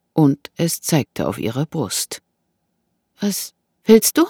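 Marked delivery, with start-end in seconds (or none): 3.2-4.3 high, frightened question; dry-mouthed fear. A narrator's reading. Woman faces a knife, dry-mouthed and afraid. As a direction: ask with fear in the throat. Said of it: "fragte sie mit trockenem Mund".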